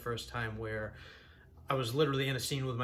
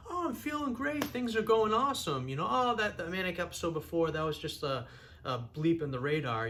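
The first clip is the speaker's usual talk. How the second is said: high, breezy put-on cheer; downplaying; 4 semitones higher than usual. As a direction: brush it off as if all is great. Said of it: "downplay how manic you are".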